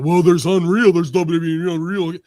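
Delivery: loud put-on defensive voice; mock protest. Host mimics wrestlers stooging out to defend the show, loud mock protest. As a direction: blurt it out loudly, imitating an excuse-maker.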